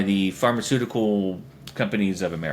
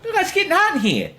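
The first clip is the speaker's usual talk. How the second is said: high, hammed-up Brooklyn voice; cartoonishly high Brooklyn wisecrack; Brooklyn accent. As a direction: push the Brooklyn accent way up in pitch. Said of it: "a Brooklyn accent".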